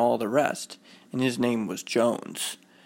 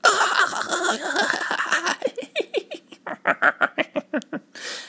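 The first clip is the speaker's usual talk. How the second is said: high, drawn-out mocking cackle; mocking laughter. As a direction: imitate boys laughing at someone. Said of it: "would laugh at Friedrich".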